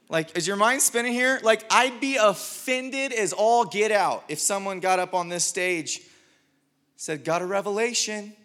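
loud, high-pitched preacher in full flow; worked-up preaching. Acted. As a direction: push it out to the whole room, incredulous.

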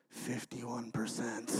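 low, hushed, slow echo; voice in the head. Retold or imitated. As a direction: drop low and soft, like a remembered line haunting you. Brother's words echo in his head.

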